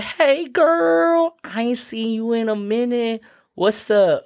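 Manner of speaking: put-on very high gossipy girlfriend voice; sassy donkey. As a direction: squeal it like greeting a bestie. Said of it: "And the donkey's like".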